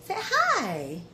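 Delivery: high, sweet coaxing voice. Real, not acted. Coaxing the toddler.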